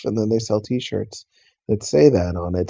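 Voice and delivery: usual speaking voice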